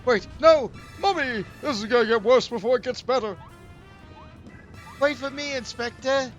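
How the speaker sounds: high, loud panicked yelling; panicked shout. Acted. Panicked calling after character.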